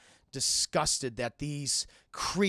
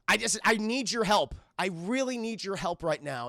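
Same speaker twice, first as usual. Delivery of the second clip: raised, insistent appeal; desperate plea; 5 semitones higher, 4 dB louder than usual. Acted. Terry begging into a silent intercom, desperate. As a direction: beg for help.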